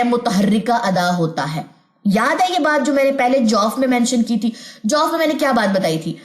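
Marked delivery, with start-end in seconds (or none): none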